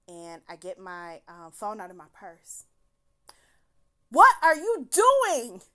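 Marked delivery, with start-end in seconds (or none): 4.1-5.6 much louder, higher, slow, imitating his yell; hammed-up furious demand; retold shout. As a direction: yell it like an angry date blocking the door. Date angrily stops her leaving.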